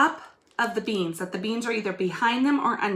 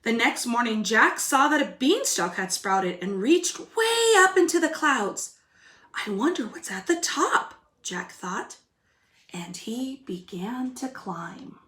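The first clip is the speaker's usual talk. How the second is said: put-on lively storybook reading for kids; reading to children; 3 dB louder than usual. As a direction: read it like a picture book to little ones. Teacher reads story aloud.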